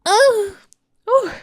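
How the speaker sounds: high, loud "uh" then "oh"; exaggerated reaction cry. Host reacts to naming the movie, with exaggerated high cries. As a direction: let out two short, high wordless cries.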